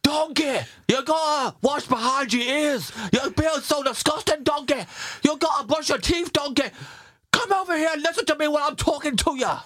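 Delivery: high, scolding Scottish ogre voice; Shrek. Shown as a demonstration. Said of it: "[name]'s Shrek impression".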